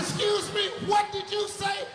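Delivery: can't believe what he just heard; stunned disbelief. Retold or imitated. Host stunned by contestant's answer, loud disbelief.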